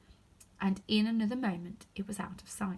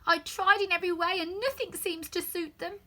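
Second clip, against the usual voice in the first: higher, louder grumbling bird; complaining pigeon; 9 semitones higher, 4 dB louder than usual. Acted. Pigeon grumbling about serpents, higher and louder. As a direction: sigh out your grievance.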